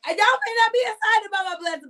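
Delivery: high and fast, bursting with joy; gushing, near tears of joy; excited. Real, not acted. Preacher to viewers, excited about her blessing, high and fast. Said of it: "but I'm excited".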